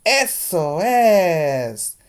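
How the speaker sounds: high, loud and very slow cry of discovery; loud drawn-out eureka shout; excited shout. Acted. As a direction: shout it out as the idea hits. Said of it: "she shouted".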